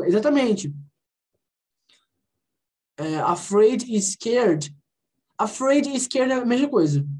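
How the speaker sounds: ordinary talk, nothing marked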